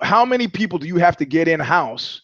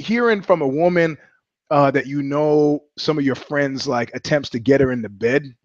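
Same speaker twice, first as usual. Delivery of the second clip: ordinary talk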